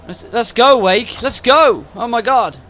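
loud, high, exasperated urging; urgent hurrying. Real, not acted. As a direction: yell at him to get moving. Urging game character to hurry.

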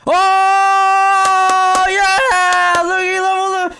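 loud, high, thrilled yelling; excited shouting. Real, not acted. Gamer cheering as his Pokemon evolves, loud and high. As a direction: cheer as your Pokemon evolves, drawing out the last words.

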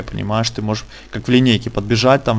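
normal talking voice

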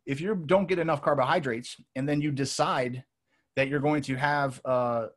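usual speaking voice

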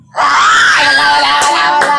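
loud outcry, words tumbling out repeatedly; excited shouting. Real, not acted. Player protesting to the others after a spin, shouting. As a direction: shout it out over the commotion.

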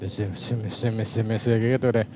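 low indistinct mumbling; mumbled talk. Shown as a demonstration. Podcast engineer demonstrating how a guest talks, mumbled. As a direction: run the syllables together in a low mumble. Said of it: "Ngomongnya kayak gini".

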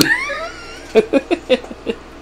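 high-pitched helpless giggling; long, high peal of laughter; real laughing fit. Real, not acted. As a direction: crack up at your own silliness. Cracks up at own noises.

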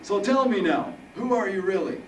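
put-on high, loud old-man voice; amused old Harold. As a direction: question him boldly as high-voiced Harold.

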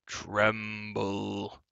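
long drawn-out, quavering giant's call; quavering cry. A narrator's reading. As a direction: draw the word out like a quavering monster. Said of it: "the quavering voice cried again".

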